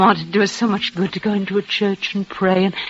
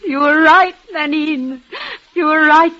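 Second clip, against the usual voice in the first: tearful; 6 semitones higher than usual. Acted. Overcome with joy, to maid.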